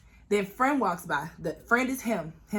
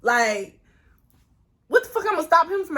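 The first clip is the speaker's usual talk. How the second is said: much higher, fast incredulous retort; much higher incredulous comeback; 11 semitones higher, 4 dB louder than usual. Retold or imitated. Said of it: "I'm looking at this bitch like".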